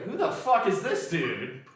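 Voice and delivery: slow, high, loud incredulous question; baffled mock reaction. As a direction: play the stunned locals hearing a stranger. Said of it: "they're like".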